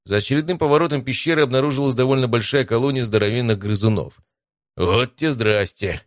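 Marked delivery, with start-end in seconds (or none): none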